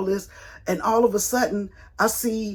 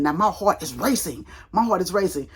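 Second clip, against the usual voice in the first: usual speaking voice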